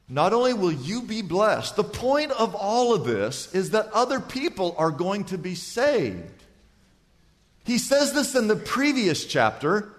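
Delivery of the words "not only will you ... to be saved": ordinary talk, nothing marked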